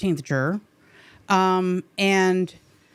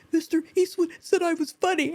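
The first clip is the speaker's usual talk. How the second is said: high, teary squeak of overwhelmed joy; tearful retelling; 8 semitones higher than usual. Retold or imitated. Actress telling her castmate what Eastwood said, tearful and high-pitched. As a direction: re-enact being moved to tears, voice way up. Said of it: "I've got tears in my eyes".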